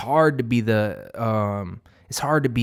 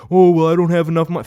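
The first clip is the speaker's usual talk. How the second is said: higher, louder, fast whiny excuse voice; mock whiny excuse; 6 semitones higher, 7 dB louder, faster than usual. Mocking people who say they can't afford it, whiny excuse voice. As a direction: ham up a lazy complainer's excuse.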